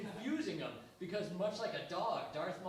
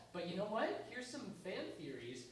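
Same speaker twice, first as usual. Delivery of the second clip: normal talking voice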